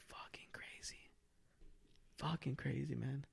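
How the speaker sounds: stunned whisper, very soft and slow. Retold or imitated. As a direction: breathe it out in disbelief. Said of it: "and I was just like".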